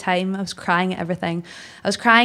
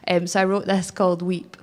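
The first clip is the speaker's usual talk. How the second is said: ordinary delivery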